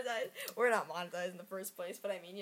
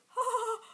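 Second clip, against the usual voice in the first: mock laugh; 14 semitones higher, 4 dB louder than usual. Car salesman mock laughing.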